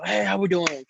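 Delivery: high, upbeat coach hello; excited greeting. Retold or imitated. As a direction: greet the team with a burst of energy. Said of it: "very excited".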